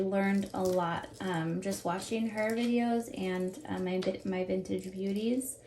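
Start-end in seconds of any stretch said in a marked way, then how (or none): none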